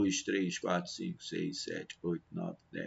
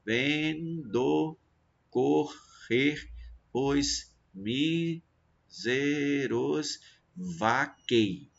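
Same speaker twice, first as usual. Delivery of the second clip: "Vendo correr os míseros vaquei" split into slow, separate syllables; ven, do, cor, rer: pause after each syllable, stopping on "vaquei"; much slower than usual. Shown as a demonstration. Teacher scanning a verse for students, syllable by syllable. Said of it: "cada sílaba vai ser um verso poético".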